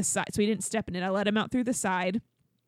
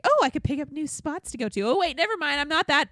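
self-talk quote; 6 semitones higher than usual. Retold or imitated. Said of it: "I'm like".

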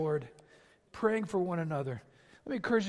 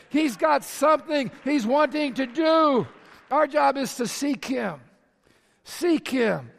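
loud, high, ringing sermon voice; full-force preaching; 8 semitones higher, 11 dB louder than usual. Acted. Pastor urging his church to seek God, preaching loudly. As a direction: preach it out to the whole room.

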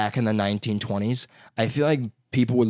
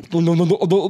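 loud, high string of no's; mock protest; 7 semitones higher, 6 dB louder than usual. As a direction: shout down the teasing. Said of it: "it's like".

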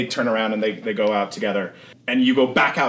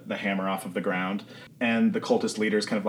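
normal talking voice

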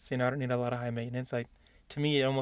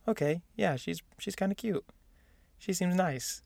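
mild approval; 5 semitones higher, 4 dB quieter than usual. Retold or imitated. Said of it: "when I saw her, I was like".

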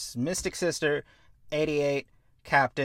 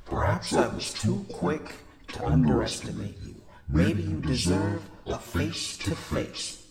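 booming villain voice; 8 semitones lower, 3 dB quieter than usual. Retold or imitated. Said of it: "the booming voice again over the comm link".

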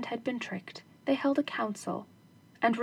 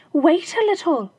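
higher, louder housewife; wife's voice; 7 semitones higher, 8 dB louder than usual. Acted. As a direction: brush him off, busy cooking. Wife answers husband.

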